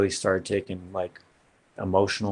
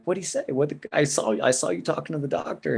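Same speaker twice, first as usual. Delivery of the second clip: anxious son; 6 semitones higher than usual. Retold or imitated. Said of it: "And I'm like".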